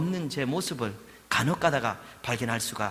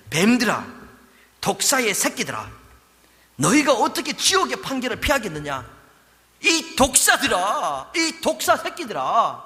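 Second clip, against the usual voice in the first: fierce condemnation; 8 semitones higher, 7 dB louder than usual. Acted. Preacher voicing Jesus condemning.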